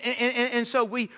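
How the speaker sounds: usual speaking voice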